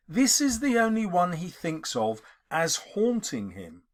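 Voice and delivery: usual speaking voice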